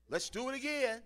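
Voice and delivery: a hint of a director who won't quit at ten thirty; exasperated director. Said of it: "Ten thirty, work night".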